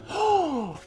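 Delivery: sharp audible intake of breath; breathy gasp. Real, not acted. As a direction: gasp in at the reveal. Gasp while unboxing the candle.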